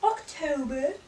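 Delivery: October drawn out in an exaggerated accent; October in a mock accent; put-on accent. Shown as a demonstration. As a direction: replay the accidental accent on October. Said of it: "say that in an accent".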